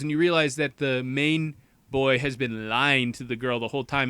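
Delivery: ordinary talk, nothing marked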